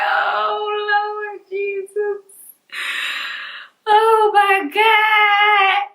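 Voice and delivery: high, drawn-out mock crying wail; hammed-up despair. Patient told of three lumps, mock tearful wail. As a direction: plead to heaven in theatrical anguish.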